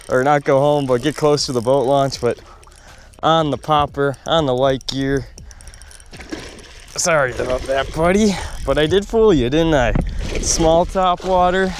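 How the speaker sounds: gloating happily at the fish; excited. Real, not acted. Talking to the caught fish.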